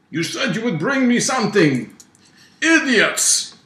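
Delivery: very high, loud angry Russian bellow; gurgling Russian boss. Acted. Boss scolding empty-handed henchmen, gurgling Russian yell. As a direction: berate them in a gurgly Russian accent. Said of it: "electronic-y gurgling voice with a Russian accent".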